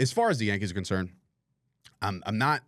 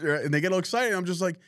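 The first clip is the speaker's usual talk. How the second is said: usual speaking voice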